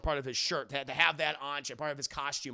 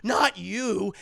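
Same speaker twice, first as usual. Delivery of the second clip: alarmed; 7 semitones higher, 8 dB louder, much slower than usual. Acted. Arthur recoils at seeing Joker.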